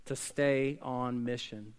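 ordinary delivery

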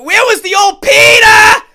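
put-on hollering at the field for the old Peter; screaming for Peter. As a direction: bellow his name like a furious fan. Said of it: "You gotta fucking be screaming out there".

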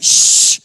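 forceful, drawn-out mock shush; loud shushing. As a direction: shush a noisy crowd hard. Pharisees acted hushing the crowd.

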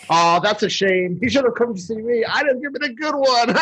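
lightly high Snow Miser. Retold or imitated. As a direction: tease with a growing grin. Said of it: "Snow Miser's talking".

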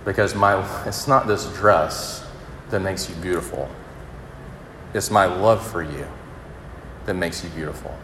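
soft, tearful father's comfort; father tenderly reassures his little girl, softly through tears. Retold or imitated. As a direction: console a crying daughter gently. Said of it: "through tears".

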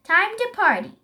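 high, loud party call; excited cry. A narrator's reading. Zebra is ready for the dinner party, excited cry. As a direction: call it out, raring to go. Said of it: "cried Zebra".

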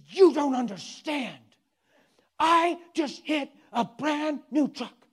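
loud, high, drawn-out mock outburst; hostile yelling. Preacher imitates grandma yelling at him, hostile. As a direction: yell it slowly, hammering each word as an imitation. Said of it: "got so hostile".

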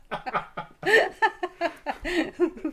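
high, loud falling laugh; helpless laughter; long real laugh. Real, not acted. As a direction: laugh it out fully. Laughing at a rude vegetable.

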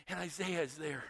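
normal talking voice

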